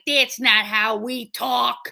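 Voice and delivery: slow, loud, broad Chicago vowels; indignant Chicago local, exaggerated accent; mock Chicago accent. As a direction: drag it out in thick Chicago. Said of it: "everyone was like".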